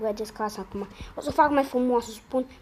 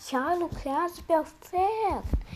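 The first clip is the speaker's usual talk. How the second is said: amazed drawl; 8 semitones higher, much slower than usual. Real, not acted. Amazed the antenna works.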